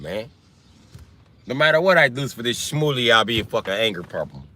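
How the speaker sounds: normal talking voice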